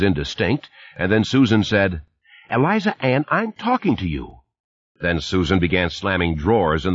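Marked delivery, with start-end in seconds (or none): 2.5-4.4 stern mother. A narrator's reading. Mother scolding child.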